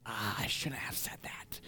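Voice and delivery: hushed, whispered regret; guilty regret. Acted. Someone feeling guilt in their heart after harsh words, guilty regret. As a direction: sigh it out softly to yourself. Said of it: "that guilt in your heart".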